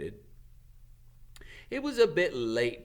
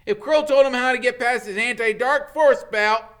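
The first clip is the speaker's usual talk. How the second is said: put-on higher, louder alarmed boy; Ron; 13 dB louder than usual. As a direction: blurt the bad news. Ron's voice.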